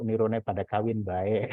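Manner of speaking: ordinary talk, nothing marked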